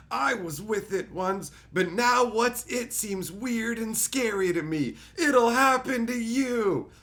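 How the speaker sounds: put-on high, slow, creaky old man; Abe Simpson. Imitating Abe Simpson warning the young, high and slow old man. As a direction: wobble it out like a cranky grandpa. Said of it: "Abe Simpson is the".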